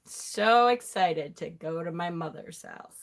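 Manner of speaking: low, subdued mock excitement; deadpan sarcasm. Daughter dreading Christmas at mother's house, deadpan sarcasm. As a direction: claim excitement with all the energy drained out.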